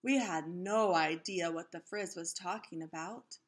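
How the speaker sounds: ordinary delivery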